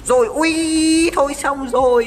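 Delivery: high, drawn-out cry of dismay; dismayed wail. Real, not acted. Gamer watching his own character die, dismayed wail. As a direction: wail loudly as it all goes wrong.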